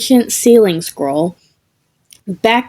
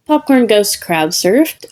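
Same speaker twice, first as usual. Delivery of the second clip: usual speaking voice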